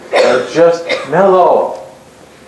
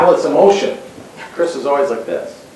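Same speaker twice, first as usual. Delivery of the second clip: usual speaking voice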